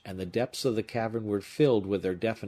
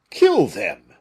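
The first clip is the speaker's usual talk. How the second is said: very loud, high outburst; shocked exclamation; 6 semitones higher, 13 dB louder than usual. A narrator's reading. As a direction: recoil at the order. Porthos aghast at the order.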